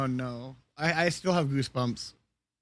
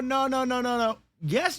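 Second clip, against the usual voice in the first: ordinary talk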